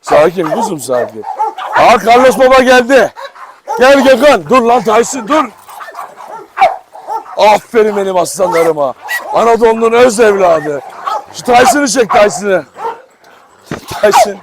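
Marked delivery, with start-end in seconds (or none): 1.8-5.4 higher, loud laughing call; excited shout. Real, not acted. Excited greeting, controlling dog. 13.7-14.4 high, loud call of the name; sharp call. Real, not acted.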